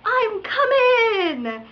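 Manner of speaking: high, loud drawn-out holler; dragged-out mock holler at another driver; retold yell. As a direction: call it out long and loud. Yelling at another driver in retelling.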